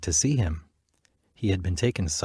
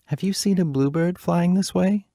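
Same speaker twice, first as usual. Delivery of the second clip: higher, hopeful asking; lightly voiced princess; 10 semitones higher than usual. Princess asking strangers about the bluebird, hopeful. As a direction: ask passers-by hopefully. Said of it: "asking everyone".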